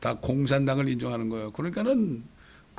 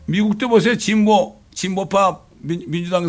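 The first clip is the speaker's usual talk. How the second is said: loud, high call to look; fiery sermon call; forceful preaching; 4 semitones higher, 6 dB louder than usual. Acted. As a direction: point the flock abroad with force. Preacher calls out loudly.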